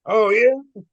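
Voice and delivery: high, loud Irish retort. Retold or imitated. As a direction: answer back loudly in an Irish accent. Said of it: "I heard an Irish voice".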